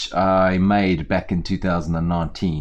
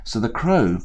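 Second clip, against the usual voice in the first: ordinary delivery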